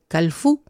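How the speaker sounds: calfú slow, with the stress on fú; calfú, slow and stressed at the end; stress on final syllable. Shown as a demonstration. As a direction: lean on the last syllable of calfú. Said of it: "Ahí pone el acentito al final de la palabra".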